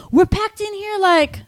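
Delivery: very high, slow put-on complaint; protesting bystander. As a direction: complain loudly from inside the crush. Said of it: "And the guy's like".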